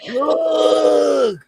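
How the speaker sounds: sudden loud call; shout. Retold or imitated. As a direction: shout it out at someone. Mock-calling out to people.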